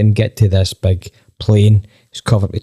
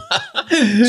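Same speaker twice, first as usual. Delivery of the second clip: high laugh; 3 dB quieter than usual. Real, not acted. Laughing at the tourists' anger.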